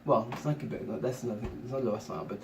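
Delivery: ordinary talk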